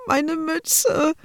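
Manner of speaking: put-on high, tearful small child's voice; a little boy wailing over his lost cap; little brother crying in the changing room, high and tearful; sobbing little boy. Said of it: "schluchzte der kleine Paul".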